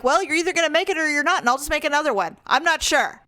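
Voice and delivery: high, fast careless mom; raccoon mother. Pretend. Voicing a careless raccoon mother, high and fast. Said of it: "They're like".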